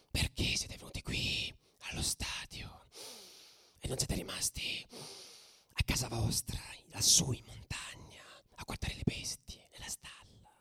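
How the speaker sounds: hissing, threatening whisper; menacing whisper. Retold or imitated. Provoking rival fans in a bar, menacing whisper. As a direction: whisper the threat to make it scarier. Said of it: "comincia a fargli".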